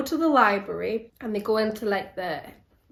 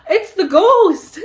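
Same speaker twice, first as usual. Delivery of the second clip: mock fright; 10 semitones higher, 10 dB louder, slower than usual. Retelling the ghost jump scare.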